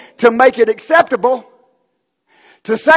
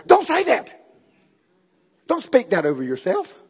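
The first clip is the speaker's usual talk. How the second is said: pitched-up scold sinking into a hushed warning; mock scolding; 6 dB quieter than usual. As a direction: imitate someone quickly correcting you. Acted scolding by others.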